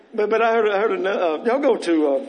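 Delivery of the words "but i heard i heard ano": ordinary talk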